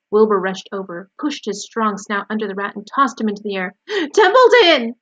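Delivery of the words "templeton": high, loud, stretched-out yell; screamed. A narrator's reading. Wilbur tosses the sleeping rat, screaming at him. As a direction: scream the name to wake him. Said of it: "screamed Wilbur".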